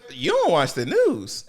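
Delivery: usual speaking voice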